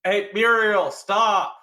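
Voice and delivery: high, loud shout to halt someone. Acted. Player shouts to a crewmate to stop, loud urgent call. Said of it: "I shout out to Muriel to stop".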